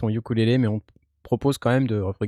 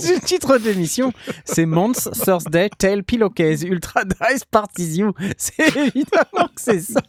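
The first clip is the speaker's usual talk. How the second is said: can barely get the words out for laughing; crying with laughter; 7 semitones higher than usual. Real, not acted. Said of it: "je pleure de rire depuis tout à l'heure".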